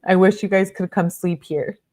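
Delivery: ordinary talk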